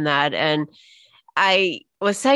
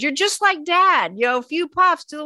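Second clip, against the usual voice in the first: teasing sister; 7 semitones higher than usual. Retold or imitated. Younger sister teasing her about the nebulizer, playful mocking voice. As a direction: tease like a kid sister poking fun. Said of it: "My younger sister was teasing me".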